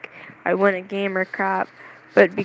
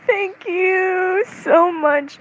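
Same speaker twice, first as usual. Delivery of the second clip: high, stretched-out gushing thanks; freaking out; 10 semitones higher, much slower than usual. Retold or imitated. Fan thanking a cosplay idol, freaking out. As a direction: gush as if jumping up and down. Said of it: "I'm, like, freaking out".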